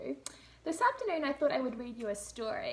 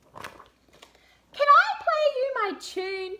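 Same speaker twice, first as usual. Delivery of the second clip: put-on very high, slow, louder sing-song boy; child's voice; 14 semitones higher, 13 dB louder, much slower than usual. Lester begging to play his tune, very high sing-song child. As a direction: stretch it out like a keen little boy. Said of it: "asked Lester".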